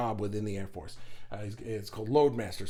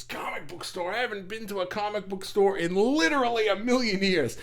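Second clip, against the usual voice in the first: higher voice, building to an emphatic "literally"; imitated incredulous boyfriend; 7 semitones higher than usual. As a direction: imitate a sitcom guy scoffing in disbelief. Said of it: "And he says".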